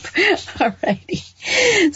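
high, drawn-out word through a laugh. Real, not acted. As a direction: chuckle your way into moving on. Laughs at own rhyming joke.

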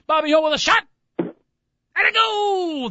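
pause, then shout a goal! up high; dramatic pause before goal. Retold or imitated. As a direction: hold a beat, then call the goal. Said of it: "That one second pause".

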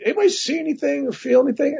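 high-pitched acted question to the room; retold urgent question. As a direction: imitate asking around, pitched well up. Retells asking the room.

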